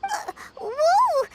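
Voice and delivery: jumpy, high startled cry; startled whoa. Acted. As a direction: recoil in surprise. Startled by talk of judgment.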